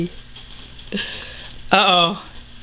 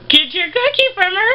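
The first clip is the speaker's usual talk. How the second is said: much higher cutesy voice cheering the rat on; 11 semitones higher than usual. Real, not acted. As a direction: egg the rat on in a tiny voice. Baby voice voicing to rats.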